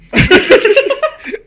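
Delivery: giggling loudly; high burst of laughter. Real, not acted. Laughs after the story.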